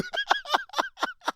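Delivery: high squealing laugh; helpless high-pitched laugh. Real, not acted. Friend cracks up.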